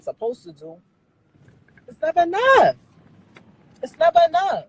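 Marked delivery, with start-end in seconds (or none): 1.9-2.8 high, loud outburst; frustrated outburst. Real, not acted. Venting that her work is never enough, loud and frustrated. 3.8-4.7 high, loud outburst; frustrated outburst. Real, not acted. Venting that her work is never enough, loud and frustrated.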